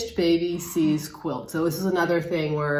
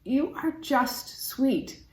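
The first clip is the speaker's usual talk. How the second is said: slow, higher, tender voice; fond cooing; 7 semitones higher, 4 dB quieter, much slower than usual. Acted. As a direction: coo it slowly to a little keepsake. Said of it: "I just wanna say to it".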